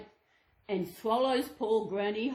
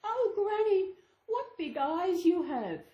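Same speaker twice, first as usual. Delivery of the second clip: put-on higher, wide-eyed little girl; wondering girl; 7 semitones higher than usual. Girl to disguised wolf, high and wondering. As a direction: marvel like a child.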